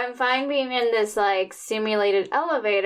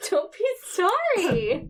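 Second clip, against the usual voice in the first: laughing; 9 semitones higher, slower than usual. Real, not acted. Laughing along with friend.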